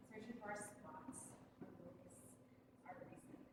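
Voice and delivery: very soft, low, nearly whispered; hushed searching. Acted. Women on mats searching for their spots, hushed suspense. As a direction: let it sink to a hush. Tense searching scene in monologue.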